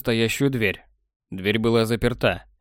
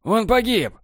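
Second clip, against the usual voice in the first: grim repetition; 10 semitones higher, slower than usual. A narrator's reading. Borland repeating the death to Technar, high insistent. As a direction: repeat it, gathering strength. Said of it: "повторил Борланд".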